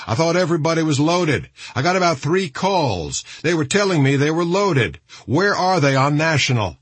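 high, pressing radio voice; concerned commander on radio. A narrator's reading.

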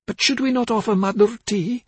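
high-pitched, hesitant question; confused mother. A narrator's reading. Confused mother asks husband.

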